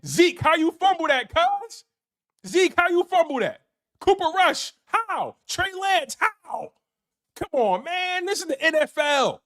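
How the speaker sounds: high-pitched mock outrage at the players; mock exasperation. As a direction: whine it up in disbelief. Exasperated rant at Cowboys players.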